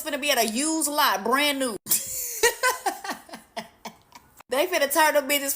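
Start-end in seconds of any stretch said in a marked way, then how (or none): 1.8-3.6 high, bright burst of laughter then fading. Real, not acted. Laughing at Elon's Teslas crashing, a high laugh. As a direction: cracks up laughing at the joke.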